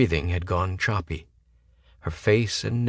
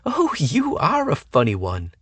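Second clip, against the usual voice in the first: tittering; 8 semitones higher, 3 dB louder than usual. A narrator's reading. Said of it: "Then she tittered".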